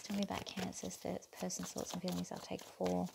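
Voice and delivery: very soft murmur to the cards; murmured request. Real, not acted. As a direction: ask under your breath.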